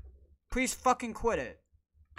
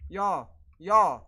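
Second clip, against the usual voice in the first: usual speaking voice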